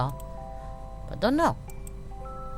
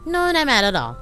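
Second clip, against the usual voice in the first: a louder, insistent no, not mad at all; firm denial; 7 semitones higher, 4 dB louder than usual. Acted. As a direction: push the denial out firmly. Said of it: "Her voice grew smaller and smaller".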